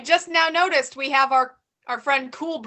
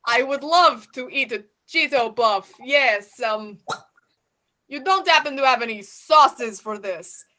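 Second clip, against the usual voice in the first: lightly put-on Russian accent. Party guest Bob denies being a Russian spy, in a light Russian accent. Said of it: "stereotype based on your accent".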